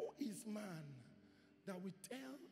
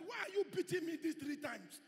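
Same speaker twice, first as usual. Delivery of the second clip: higher, louder shouted donkey voice; shouting donkey in a sermon; donkey's complaint; 8 semitones higher, 4 dB louder than usual. Acted. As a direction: cry out as the wronged donkey. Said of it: "A donkey spoke and said".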